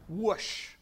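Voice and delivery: sudden whoosh of rushing wind; whoosh, like sudden mighty wind. Shown as a demonstration. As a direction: imitate a gust arriving all at once. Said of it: "that sound of the wind".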